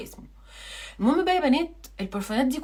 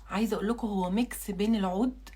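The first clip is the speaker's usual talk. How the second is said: ordinary talk, nothing marked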